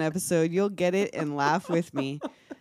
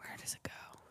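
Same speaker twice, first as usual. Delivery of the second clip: hushed, voiceless whisper; 23 dB quieter than usual. Pretend. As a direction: whisper it like a secret joke. Joking hushed echo of running gag.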